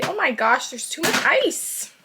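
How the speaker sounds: exclamation climbing high and slowing at the end; dismayed surprise. Real, not acted. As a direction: react with sudden dismay, stretching the last words. Notices too much ice.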